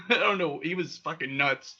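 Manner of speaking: normal talking voice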